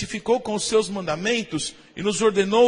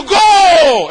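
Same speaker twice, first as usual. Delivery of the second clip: huge, high stadium yell of "Gol!"; explosive goal cry; goal shout; 7 semitones higher, 14 dB louder than usual. Retold or imitated. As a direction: bellow "Gol!" like a fan at a match. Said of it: "quando Deus tá gritando".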